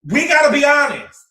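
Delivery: loud, excited call to the chat; hyped-up chat shoutout. Real, not acted. Hyping the viewers who typed fives, loud and excited.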